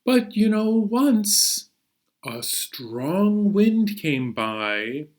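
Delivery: put-on soft, hushed read-aloud for children; storybook reading. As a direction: draw the kids into the tale gently. Reads the picture book aloud.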